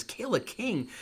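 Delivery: high-pitched, acted disbelief; shocked queen. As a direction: echo the accusation aghast. Queen echoes the accusation, shocked.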